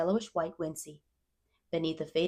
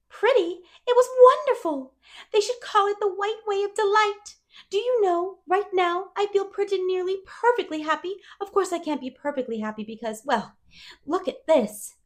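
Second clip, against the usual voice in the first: put-on higher, eager girl voice; dreamy chatterbox girl; 14 semitones higher, 8 dB louder than usual. A narrator's reading. Anne telling Matthew about dresses, wistfully eager.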